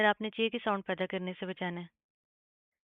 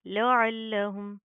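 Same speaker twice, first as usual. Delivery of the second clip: slow, louder with firm doubled ल; tajweed recitation; 4 dB louder, much slower than usual. Shown as a demonstration. Teacher reciting with shadda for students. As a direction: press the doubled ल firmly. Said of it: "सख्ती से जमा कर पढ़ेंगे".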